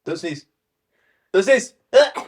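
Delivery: sudden loud pleading cry; loud urgent plea. Real, not acted. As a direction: shout it to stop a sneeze. Friend about to sneeze.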